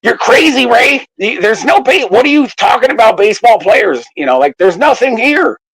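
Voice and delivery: high mocking scoffing voice; mocking banker brother. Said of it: "who's just like".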